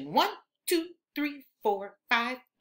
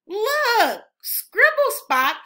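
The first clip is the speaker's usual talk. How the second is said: much higher and louder call to look; excited look; 10 semitones higher, 8 dB louder, much slower than usual. Acted. Narrator to children, showing Scribble Spot calmer, excited call. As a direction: point it out with delight.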